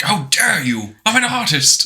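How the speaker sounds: high, offended "I'm an artist"; indignant artist. Retold or imitated. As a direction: play the huffy artist. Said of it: "he's like".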